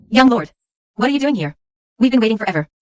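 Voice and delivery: loud and put out, demanding attention; loud complaint. Acted. Servants complain to the young lord, loud indignant call.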